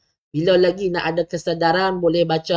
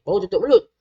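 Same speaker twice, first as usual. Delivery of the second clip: ordinary delivery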